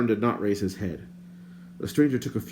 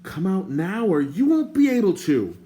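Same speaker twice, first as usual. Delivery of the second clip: loud, higher warning yell; threatening shout; 10 semitones higher, 5 dB louder than usual. A narrator's reading. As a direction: bellow the threat at him. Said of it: "Terran shouted".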